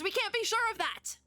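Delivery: very high, hammed-up worry; fretful. Orbit doubting the frog will be careful, fretful. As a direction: squeak it out in mock distrust.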